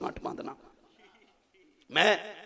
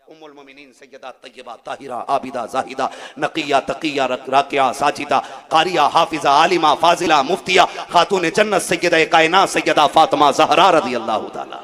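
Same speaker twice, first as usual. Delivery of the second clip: preacher's honorific litany; 17 dB louder than usual. Acted. Preacher honouring Fatima with her titles, building loud declamation.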